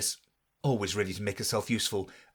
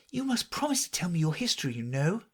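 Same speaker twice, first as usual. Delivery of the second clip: put-on higher, quick girlish voice; young Alice; 7 semitones higher, faster than usual. A narrator's reading. Alice asking the mouse for its story, girlish. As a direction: ask eagerly like a curious girl.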